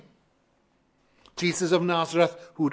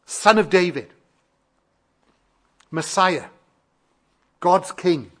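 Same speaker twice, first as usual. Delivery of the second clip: louder retold plea, each title set apart; calling out in faith; 3 semitones higher, 5 dB louder than usual. Blind man appeals to Messiah for mercy, calling out in faith. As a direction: proclaim the beggar's cry with spaced-out weight. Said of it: "He calls out in faith".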